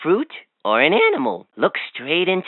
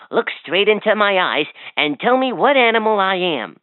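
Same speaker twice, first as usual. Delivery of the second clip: ordinary delivery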